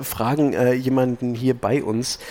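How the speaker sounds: ordinary talk, nothing marked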